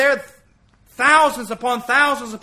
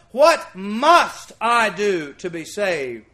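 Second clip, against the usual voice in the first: very slow, louder, dropping low; slow emphatic question; 4 semitones lower, 4 dB louder, much slower than usual. Shown as a demonstration. Preacher pressing the congregation, slow, loud and low. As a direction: hammer the question home word by word.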